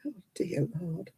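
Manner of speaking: very soft, whispered retold thought; hushed, appalled aside; inward dismay. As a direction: murmur it to yourself in dismay. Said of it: "And I'm thinking".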